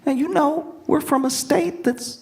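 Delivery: very high, airy gushing; mock awestruck, high and whispery; imitating excited girlfriend. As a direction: gush in a hammed-up girlfriend voice. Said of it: "then she's all excited".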